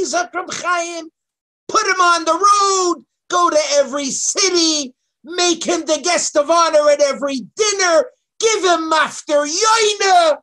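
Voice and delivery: high, loud, near crying; fiery preaching. Acted. Rabbi preaching to the class about the missed kiddush Hashem, loud and choked. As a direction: pour it out like a pulpit crescendo.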